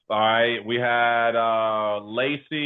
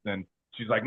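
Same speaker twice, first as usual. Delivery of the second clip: normal talking voice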